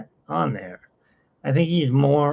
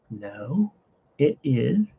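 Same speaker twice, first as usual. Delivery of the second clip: ordinary talk, nothing marked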